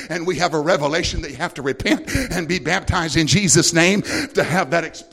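ordinary talk

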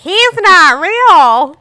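put-on high, loud, very slow child's voice; mock child announcing the truth; kid blurting it out. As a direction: drag it out like a little kid spoiling the secret. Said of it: "And she's like".

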